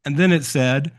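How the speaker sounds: ordinary delivery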